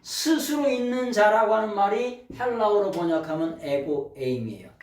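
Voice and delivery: normal talking voice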